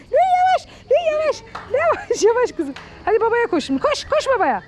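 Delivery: high, loud calling to the dog; calling out excitedly. Real, not acted. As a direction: call the dog across the yard.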